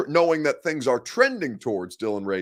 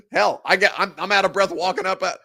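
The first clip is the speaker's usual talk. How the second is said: ordinary talk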